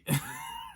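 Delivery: sudden high squeaky laugh; laughing at your own comeback; high squeal of laughter. Real, not acted. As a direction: burst into a shrill-pitched giggle.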